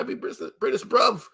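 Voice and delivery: a mock British accent on bruv; lightly put-on London accent. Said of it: "why they just like".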